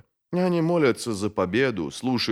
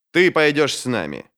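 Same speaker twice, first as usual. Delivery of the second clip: higher, firm sharp order; 6 semitones higher, 4 dB louder than usual. A narrator's reading. Bayaz ordering his apprentice to come along, sharply. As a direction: cut in with a curt command. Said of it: "резко сказал он Ки".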